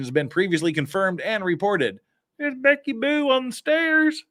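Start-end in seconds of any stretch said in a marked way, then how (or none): 2.4-4.2 high, slow, cooing name call; cooing sing-song. Acted. Streamer cooing at Becky on the stairs, high. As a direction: coo it like a pet name.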